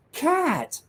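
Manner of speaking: squeaky high voice for the cat. Retold or imitated. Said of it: "She's like".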